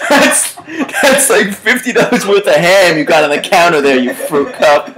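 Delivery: high, laughing delight; laughing reread. Shown as a demonstration. Host rereads his favorite comment to cohost, laughing. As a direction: relish the insult through laughter. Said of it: "That's a great comment".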